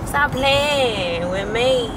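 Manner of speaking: slow, drawn-out mock JT accent; playful JT accent attempt, unhurried; JT's accent. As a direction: stretch each word in an imitated JT accent. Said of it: "I wish I had an accent".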